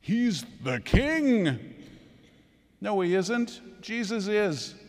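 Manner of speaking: high, loud, slow preaching shout; fiery proclamation. Real, not acted. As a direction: thunder it from the pulpit, slow and high. Preacher proclaims Jesus over [name].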